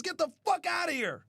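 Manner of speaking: usual speaking voice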